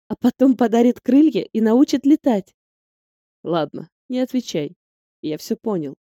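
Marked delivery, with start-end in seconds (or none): 3.4-5.9 mysterious smile. A narrator's reading. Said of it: "Загадочно улыбается".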